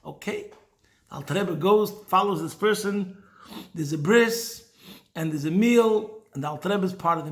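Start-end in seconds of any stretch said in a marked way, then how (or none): none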